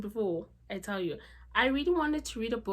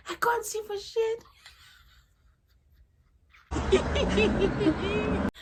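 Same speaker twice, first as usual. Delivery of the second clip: high, squealing real laughter through the words; helpless laughter; 4 dB louder, much slower than usual. Real, not acted. Vlogger mistook her straw for a dot, helpless laughter. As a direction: crack up at yourself, voice shooting up.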